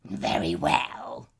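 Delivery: troll grudgingly agreeing, growling low. A narrator's reading. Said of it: "he growled".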